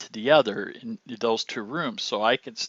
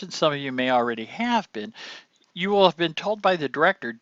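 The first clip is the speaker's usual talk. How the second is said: usual speaking voice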